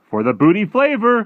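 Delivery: very high, very loud interjection; giant's cry. Acted. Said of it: "cried the B F G".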